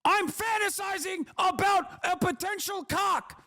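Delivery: high, soft, slow mock whine; mock-breathy imitation of a leering viewer; mocking quote. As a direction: sneer it as a creepy fantasist. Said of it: "you go".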